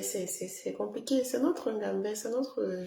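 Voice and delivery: ordinary talk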